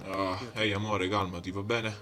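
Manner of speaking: soft, low calming voice; calm her down gently; soothing. Pretend. Calming partner after crash.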